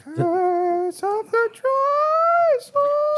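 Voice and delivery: drawn-out high spooky croak; frog voice. Acted. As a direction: stretch it like a cursed frog. Dramatic echo of cursed name.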